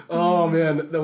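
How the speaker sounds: ordinary talk, nothing marked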